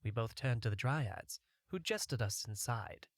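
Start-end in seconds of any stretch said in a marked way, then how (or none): none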